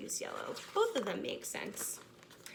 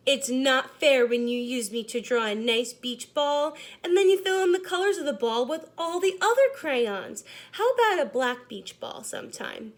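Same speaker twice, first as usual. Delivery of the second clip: lightly voiced, mildly indignant crayon; 2 semitones higher, 8 dB louder than usual. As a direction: grumble about being only the outline.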